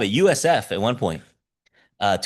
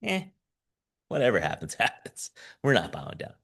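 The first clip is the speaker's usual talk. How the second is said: mock nonchalant; 2 semitones lower, 7 dB quieter, faster than usual. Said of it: "kinda like".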